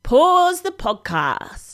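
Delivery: slow, drawn-out announcer call; slow announcement. Acted. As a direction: announce the break slowly. Announces podcast break.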